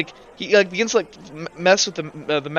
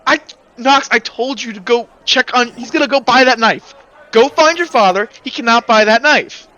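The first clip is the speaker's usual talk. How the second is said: loud, higher exasperated mother; scolding mom; 4 semitones higher, 5 dB louder than usual. Acted. Mom scolding her son to go find his father. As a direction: scold him like a fed-up mother.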